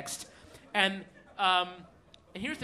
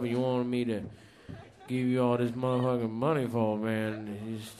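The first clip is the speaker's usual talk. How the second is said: Doctor Pepper's set; 12 semitones lower than usual. Retold or imitated. Doing a friend's stand-up set verbatim for the crowd, deep and weary. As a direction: play a tired burnout comedian, much lower. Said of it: "I'm gonna do his set verbatim".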